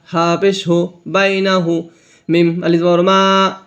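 ordinary talk, nothing marked

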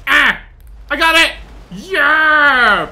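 loud, high yells of success; triumphant shout. Real, not acted. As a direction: crow at landing the shot. Gets the shot in the game.

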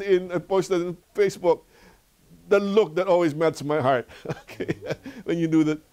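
ordinary talk, nothing marked

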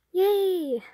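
high bright squeal of joy; gleeful cheer. Acted. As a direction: cheer like you just won. Idol wins the argument.

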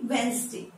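"वेंसडे" as WENS-day; silent D. Shown as a demonstration. Teacher summing up the silent letter, correctly pronounced. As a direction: say "वेंसडे" with no D before the N. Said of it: "डी को हम pronounce नहीं".

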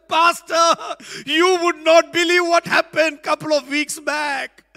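bursting to tell the pastor; mother telling pastor the news, excited; excited mom. Retold or imitated. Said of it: "comes out and said".

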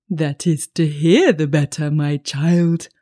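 lightly voiced wolf, reassuring; a hint of disguised creature, falling at the end; wolf as grandmother. A narrator's reading. Wolf explaining himself to the child, mild wolf voice.

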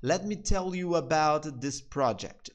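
slow, clear example sentence; slow demo. Shown as a demonstration. Teacher giving another example to students, slow. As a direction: give it word by word. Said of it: "Ou então assim, ó".

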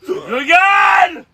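loud, high exclamation; pained shout. Real, not acted. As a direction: shout it through the burn. Burning spice reaction.

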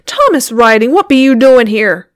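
loud, scolding demand. A narrator's reading. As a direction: confront your husband loudly. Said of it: "she demanded".

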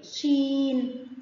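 "شين" very slow, ee stretched out; long ee. Shown as a demonstration. Teacher naming the letter for pupils, "شين" with long ee. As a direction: draw out the ee before the final n of "شين". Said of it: "فيه مد بالياء".

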